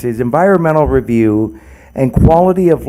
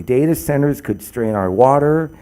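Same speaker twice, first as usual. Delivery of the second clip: normal talking voice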